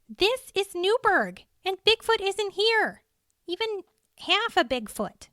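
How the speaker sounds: insistent woman. A narrator's reading. Cheryl firmly dismissing Andy's claim.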